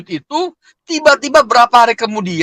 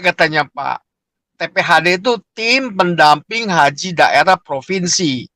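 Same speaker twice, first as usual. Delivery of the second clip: usual speaking voice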